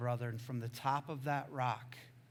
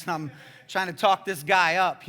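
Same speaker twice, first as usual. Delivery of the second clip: ordinary talk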